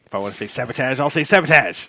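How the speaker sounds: lightly imitated indignant star digging in; William Shatner. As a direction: insist on "sabotage" as SAB-uh-tazh, rising in force. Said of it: "he started going off on a production assistant".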